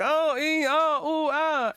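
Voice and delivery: put-on high, squeaky girlish whimpering; little girl whimpers. Prince recapped whimpering like a little girl after falling. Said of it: "starts acting like a little girl".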